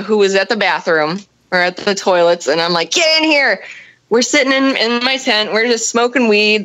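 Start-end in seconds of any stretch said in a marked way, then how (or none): 2.9-3.6 higher, mildly urgent summons; urgent call. Retold or imitated. Camper grabbing friend from the toilets, acted urgent call.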